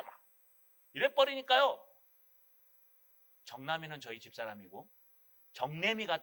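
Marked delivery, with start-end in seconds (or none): none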